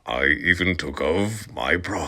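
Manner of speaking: normal talking voice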